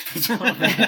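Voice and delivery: laughing. Real, not acted. Laughing about weird technique.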